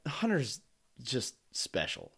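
very soft, pitying aside; slow, hushed acted remark; hushed mock pity. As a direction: voice a listener quietly making excuses for someone. Imagined listeners pitying the host.

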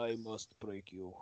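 deep, slow, flat menace; a hint of the Russian boxer; low slow Drago voice. Quoting Drago line.

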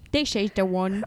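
silly, slurred mock British; badly faked British with slushy consonants; British accent. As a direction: play up a fake British accent. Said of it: "This is my British accent".

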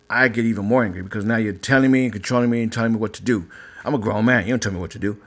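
ordinary delivery

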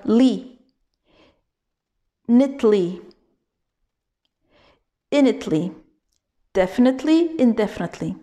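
li, neatly, initely, definitely, indefinitely, growing one syllable at a time with pauses; built up from the end. Shown as a demonstration. Teacher drilling indefinitely from its last syllable, piece by piece backwards. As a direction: rebuild indefinitely backwards from li, pausing between pieces. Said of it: "la syllabe de la fin".

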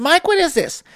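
put-on high, puzzled young coworker voice. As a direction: voice the confused cashier asking. Coworker asks about odd order.

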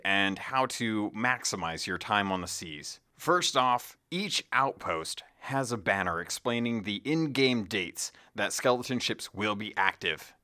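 ordinary talk, nothing marked

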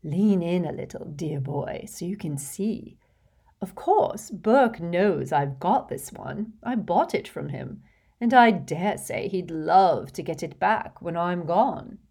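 put-on fussy, gushing rich old lady, laughing in; a vain old witch showing off to a charming boy; giggly hostess voice. Hepzibah confiding to Tom.

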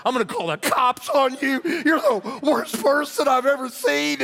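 high, loud tirade, acted out; mad outburst. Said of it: "I was mad at Clint".

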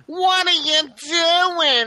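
high, very slow mom scolding; kid's mom barging in, mock scolding; mock angry mom. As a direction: drag it out like a shocked mother. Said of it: "mom has to come in and be like".

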